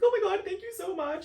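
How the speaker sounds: loud, high-pitched wail of thanks; mock-crying squealed thanks; tearful gratitude. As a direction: gush it as if sobbing with relief. Retold tearful thanks after rescue.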